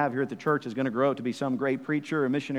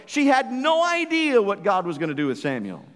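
raised, ringing pulpit voice; fervent preaching; 6 semitones higher, 3 dB louder than usual. Acted. Preacher urging parents in the church to raise children for God, fervent and raised. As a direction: preach it out to the pews with full conviction. Preacher rises to full force.

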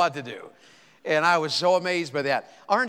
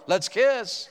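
lightly squawked bird line, higher; parrot voice; 6 semitones higher than usual. Acted. Preacher voicing the widow's parrot, light squawk. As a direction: play the parrot blurting it out. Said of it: "her bird would go".

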